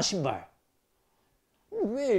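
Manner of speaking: usual speaking voice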